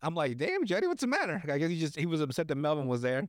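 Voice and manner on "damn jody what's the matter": high-pitched, baffled question; mock concern. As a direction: react in a raised, incredulous voice. Acted reaction to the film character.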